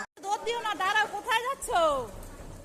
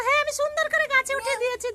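proud shout; 8 semitones higher, 5 dB louder than usual. Pretend. Proud child, loud.

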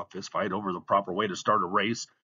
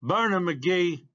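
higher, drawn-out call; lone supporter's cheer; 7 semitones higher, 3 dB louder, slower than usual. A narrator's reading. Lone fan cheering from a car roof, called out. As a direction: let one cheer stand out from the crowd. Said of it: "voice separated itself from the others".

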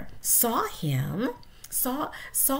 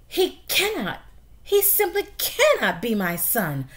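loud, anguished father mouse, first word pitched high; despairing father mouse; 5 semitones higher, 5 dB louder than usual. Acted. Father mouse despairing over son.

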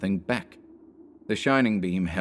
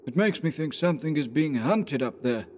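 higher voice for the uneasy companion; wary woodsman, voice pitched up; Anderson, uneasy; 7 semitones higher than usual. A narrator's reading.

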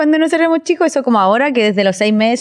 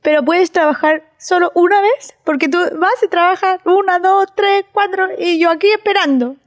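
put-on lightly higher, pleading kid; a hint of a complaining child; 6 semitones higher than usual. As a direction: nag like a lonely little girl. Daughter's complaint imitated. (un toque de niña quejosa)

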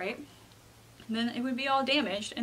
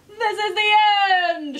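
shouting crowd; 9 semitones higher, 10 dB louder than usual. A narrator's reading. Everyone in the streets panics, acted loud shout. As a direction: wail it out in hammed-up panic. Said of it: "shouted everyone".

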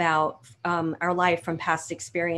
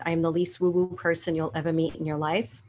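ordinary talk

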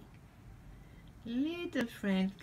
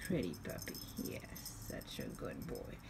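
whispered praise to a pet; whispering; 12 semitones lower, 10 dB quieter than usual. Real, not acted. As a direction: whisper sweetly to the cat. Praising the cat softly.

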